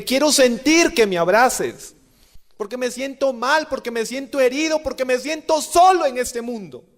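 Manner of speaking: begging God for a hug, close to crying; retold tearful prayer. Man kneeling before the Sacrament, pleading with God through tears. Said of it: "lo único que hacía era llorar y llorar".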